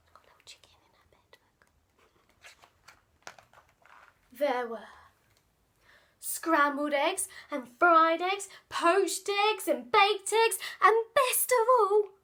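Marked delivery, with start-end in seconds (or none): 0.1-3.3 rushed whispered aside. Real, not acted. 6.2-12.1 rising excitement. A narrator's reading. List building with excitement.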